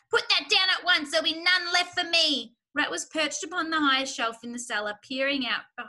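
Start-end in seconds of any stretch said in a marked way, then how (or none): 0.1-2.5 high, loud indignant scolding; shrieking. A narrator's reading. Rat guarding the cider from intruders, shrieking. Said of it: "shrieked Rat".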